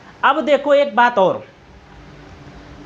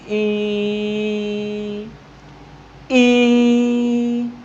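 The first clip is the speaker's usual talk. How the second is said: long vowel demo. Shown as a demonstration. Teacher sustaining ई for the class, drawn out. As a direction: hold ई steady and long. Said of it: "का बोले तो ई".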